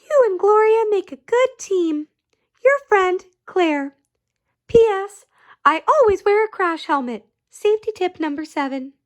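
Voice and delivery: put-on child's letter. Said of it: "It said".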